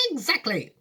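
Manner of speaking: high, earnest Wonka voice; serious Wonka. Acted. Wonka answering Charlie seriously, in a high voice.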